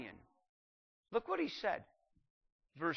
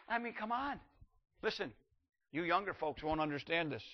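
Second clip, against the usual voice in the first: ordinary talk, nothing marked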